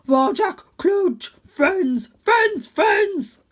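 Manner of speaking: loud, high joyful barking chant; excited big dog. A narrator's reading. Dog celebrating his friendship loudly. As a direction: bounce on each word.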